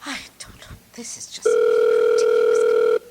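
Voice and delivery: whispered grumble to herself; muttered under breath. Acted. Invalid alone on the line whispers her annoyance. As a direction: hiss it under your breath, fed up.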